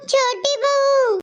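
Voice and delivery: slow, stretched call across the house; drawn-out call. Acted. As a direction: stretch out बहू to call her from another room. Mother-in-law calling younger bahu. (खींचकर लंबी आवाज़ में पुकारना)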